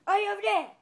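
high, loud shout into the distance; calling out. Pretend. Searching for a missing friend, loud high call. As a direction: holler it out to someone hidden.

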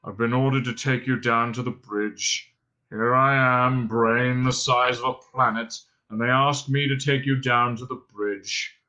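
slow, gloomy monotone; droning robot. A narrator's reading. Robot complaining about his menial order, droning gloomily. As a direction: drone on, put-upon and joyless. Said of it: "he droned".

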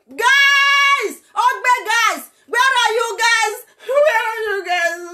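high, crying call to the missing guys; wailing call. Real, not acted. Calling the youths to come, high wailing cry. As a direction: cry out for them, voice breaking high.

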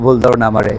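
ordinary talk, nothing marked

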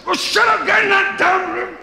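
high, loud hammed-up scolding shout; yelling parent. Parent ordering noisy kids into a room, shouting. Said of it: "kids making a hell of a noise".

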